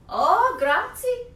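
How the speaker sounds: high, loud, slow grateful grazie; an eager young helper thanking her; Big Anthony. Acted. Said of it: "said Big Anthony".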